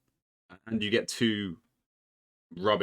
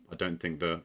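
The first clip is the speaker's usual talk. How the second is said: normal talking voice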